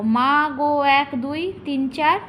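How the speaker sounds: two stretched syllables; slow syllable count. Shown as a demonstration. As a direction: draw out each syllable to count it. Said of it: "নিচেটা দেখো".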